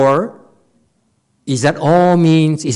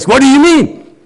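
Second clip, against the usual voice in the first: high, louder snappy challenge; mean retort; 8 semitones higher, 6 dB louder than usual. Retold or imitated. Teacher showing how he would snap back, high sharp retort. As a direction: hit back with a sharp edge. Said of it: "I would show my meanness right away".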